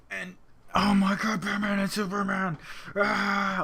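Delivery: high, squealing fan excitement; fanboy excitement. Real, not acted. Fan hearing the Batman and Superman news, high excited squeal. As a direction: squeal it like the news just broke.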